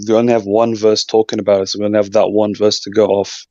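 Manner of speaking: ordinary talk, nothing marked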